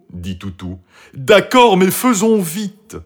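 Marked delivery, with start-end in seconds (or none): 1.1-3.0 high, loud, drawn-out dog voice; dog giving in. A narrator's reading. Toutou gives in to the fox, urgent. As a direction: agree high and emphatic.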